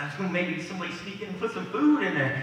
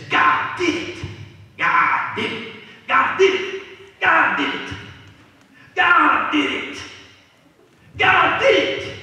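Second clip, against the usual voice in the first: shouted high and joyous; hollering praise; 10 semitones higher, 11 dB louder, slower than usual. Acted. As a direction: shout it like a jubilant praise cry. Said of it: "hears her hollering around".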